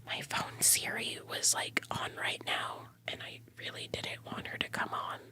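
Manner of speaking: hushed whisper so Siri won't hear. Real, not acted. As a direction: whisper it to stay unheard. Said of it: "I was freaking out".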